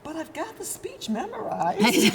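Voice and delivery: high, loud laughing retelling; amused at her own boldness, laughing. Real, not acted.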